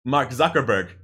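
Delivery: ordinary delivery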